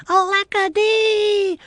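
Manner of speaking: very high, slow, loud lament; wailing shout. A narrator's reading. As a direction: shout it out as a despairing tiny man.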